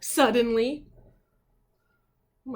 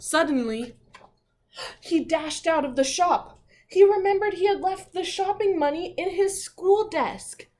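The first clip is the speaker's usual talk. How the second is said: higher, louder, racing narration; excited story reading; 8 semitones higher, 8 dB louder than usual. A narrator's reading. Teacher reading the pig's dash out of the shop, higher and louder. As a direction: build the suspense.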